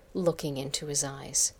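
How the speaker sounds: ordinary delivery, nothing marked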